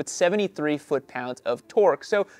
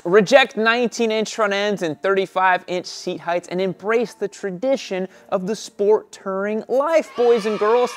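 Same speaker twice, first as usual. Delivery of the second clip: grand showman announcing to the crowd; booming ringmaster-style presentation; florid victorian lecturer; 4 semitones higher, 6 dB louder than usual. Acted. Showman-style intro to bike review.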